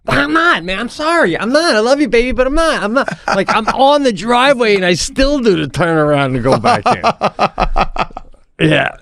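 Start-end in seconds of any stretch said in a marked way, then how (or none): 0.1-3.1 much higher, whiny apologetic plea; pleading apology. Retold or imitated. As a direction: beg off sweetly but firmly, higher. Backing out to wife.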